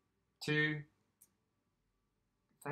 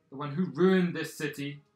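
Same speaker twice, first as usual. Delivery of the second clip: loud, carrying accusation; projecting; 3 semitones higher, 12 dB louder than usual. Acted. Minister accusing an upstart so others can hear, projecting. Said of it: "starting to project his voice so that others can hear".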